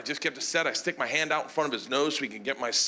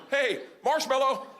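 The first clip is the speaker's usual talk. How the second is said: nervous safe-word call; 8 semitones higher than usual. Retold or imitated.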